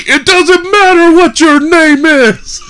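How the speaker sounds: high, drawn-out catchphrase shout; God's booming retort. Retold or imitated. God cutting Billy off in the promo, slow full shout. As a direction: roar it slowly as the promo's punchline. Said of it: "God himself spoke to you".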